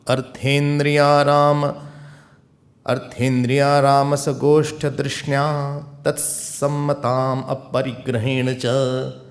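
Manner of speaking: slow, chanted Sanskrit recitation; verse recitation. Real, not acted. Preacher recites the next shloka to the listeners, slow chant. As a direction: intone the shloka slowly.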